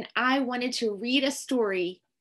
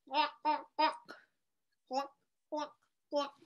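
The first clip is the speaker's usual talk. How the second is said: soft, short clucks; chicken clucking; 10 dB quieter, faster than usual. Pretend. Reader plays chicken for the children, clucking. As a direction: cluck in small quiet bursts. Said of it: "Cluck like a chicken".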